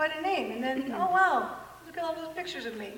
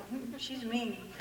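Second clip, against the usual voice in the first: creepy guys muttering; 11 dB quieter, much slower than usual. Retold or imitated. Imitating creepy guys driving by.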